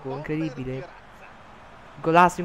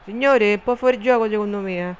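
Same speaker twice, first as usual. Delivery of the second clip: usual speaking voice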